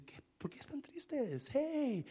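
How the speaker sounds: soft, slow, kindly asking; gentle question. Retold or imitated. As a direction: lean in and ask gently. Jesus approaches the sad disciples. (pregunta suave y tierna)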